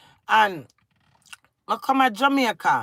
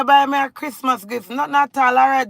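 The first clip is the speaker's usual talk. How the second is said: normal talking voice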